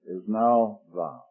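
soft, low, reverent; hushed tender. Acted. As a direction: say it like a quiet revelation. Tender address to God.

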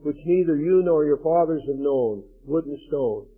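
solemn, emphatic Bible reading; solemn scripture reading to a congregation. Acted. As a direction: proclaim the warning like a preacher. Reads Deuteronomy curse passage.

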